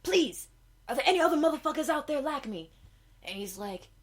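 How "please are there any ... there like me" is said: high, loud and fast; frantic excitement. Acted. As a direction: rush it out, overjoyed. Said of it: "she's like".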